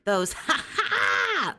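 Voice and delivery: squeaky ha ha, drawn out slowly; a fake, strained high-pitched giggle; forced high laugh. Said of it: "kind of laughs where, I can't even get my voice that high".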